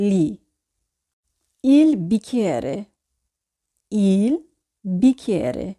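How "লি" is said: "লি" for Italian gli, with the g left silent. Shown as a demonstration. Teacher shows how gli sounds, "লি" with a silent g. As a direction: say "লি", no hard g. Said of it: "তো এখানে উচ্চারণ হবে লি".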